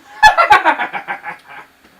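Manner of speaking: high, loud villain cackle; evil cackle; maniacal laugh. Acted. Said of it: "I call it my zombie voice".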